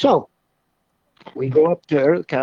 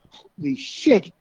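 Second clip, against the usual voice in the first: high, slow startled curse; 11 semitones higher, slower than usual. Retold or imitated. Telling how his friend jumped back, mock startled curse. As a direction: mimic the jolt of the blast.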